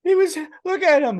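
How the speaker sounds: very high, loud wailing sob voice; hammed-up crying at a dog video; mock tearful. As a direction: wail it through fake tears. Retold tearful pleading to wife.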